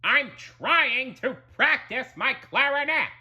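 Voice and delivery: high-pitched irritated protest; put-upon, snarly cartoon grouch; Squidward, snarling. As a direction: complain in an exasperated snarl.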